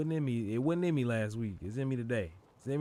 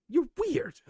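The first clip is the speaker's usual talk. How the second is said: mock squeaky high; 7 dB louder than usual. Teasing the game character.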